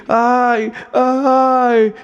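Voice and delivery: high mock moan of pain; two short pained cries, lightly imitated; retold moans of pain. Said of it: "gemidos de dor".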